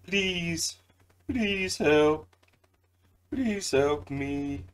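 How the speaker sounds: high, ghostly pleading moan; creepy voice. Retold or imitated. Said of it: "in a really kinda creepy voice".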